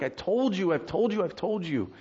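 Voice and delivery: mock frustrated insistence; frustrated parent. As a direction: act out a parent who is fed up. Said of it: "we get frustrated, like".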